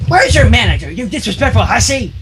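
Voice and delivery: a hint of an old crone yelling; cranky old mare shouting. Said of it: "the old pony shouted".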